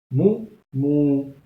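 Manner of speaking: mou, mou with the ou doubled in length; lengthened vowel. Shown as a demonstration. Teacher demonstrates the long ou. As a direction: draw out each mou. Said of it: "je double la longueur".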